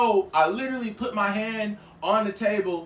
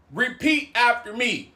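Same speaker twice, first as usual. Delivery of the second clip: slower, deliberate retold order; acted-out measured insistence; slow stern command; 3 semitones higher than usual. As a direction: spell out the order word by word. Same command repeated more slowly.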